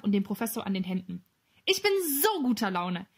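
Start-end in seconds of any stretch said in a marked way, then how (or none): 1.7-3.1 high, delighted voice; happy exclamation. A narrator's reading. Emil to Gustav and the Professor, delighted. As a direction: say it with joy, pitched up. Emil is overjoyed, takes their hands.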